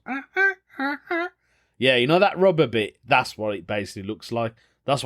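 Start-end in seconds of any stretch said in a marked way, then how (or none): 0.0-1.3 high squeaky honking noises; clown horn honk. Retold or imitated. Vlogger imitating a horn for viewers, high squeaky honks. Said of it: "where you squeeze it and it goes".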